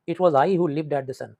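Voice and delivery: drawn out, slow, heavy words; slow poem recitation. Shown as a demonstration. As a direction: read the verse slowly and with regret. Teacher reciting the poem line. (धीमा, भावुक कविता पाठ)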